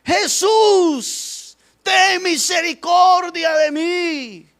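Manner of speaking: high, drawn-out desperate shout. Acted. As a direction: cry out at full stretch, slow and high. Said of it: "gritaba más todavía".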